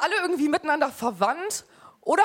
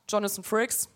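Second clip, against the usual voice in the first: low, quiet ominous name drop; deadpan creepy show host voice; horror trailer voice; 5 semitones lower, 4 dB quieter than usual. Acted. Gives host's name, same low voice.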